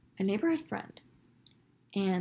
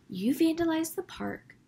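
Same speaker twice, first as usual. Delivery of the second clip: mock accusation; 8 semitones higher than usual. Lady accusing, retold.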